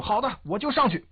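high-pitched voiced reply of an enemy officer; 伊藤应答. A narrator's reading. Narrator voices the officer answering back. (用高调的角色声音应答)

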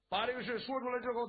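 plain reading voice

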